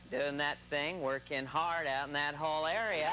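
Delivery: presidential impression blending two voices; kindly host crossed with cowboy star; George Bush. Shown as a demonstration. Comic demonstrating the combined result, presidential impression. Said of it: "if you put 'em together".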